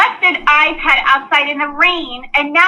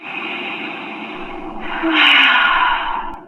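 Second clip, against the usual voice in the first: long calming sigh; 3 dB quieter than usual. Acted. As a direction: let all the anger out in one breath. Dana breathes to calm down.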